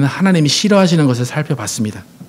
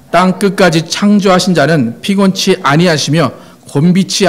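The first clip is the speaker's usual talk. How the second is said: strong, declamatory scripture reading; reading out loud; 2 semitones higher, 3 dB louder than usual. Real, not acted. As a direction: lead the congregation in reading aloud. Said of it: "큰 소리로 시작".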